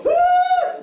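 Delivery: very high mock gasp of outrage; booing gasp. Comedian mimicking audience outrage at a name, shrill gasp. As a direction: squeal like an offended crowd member. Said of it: "some people go".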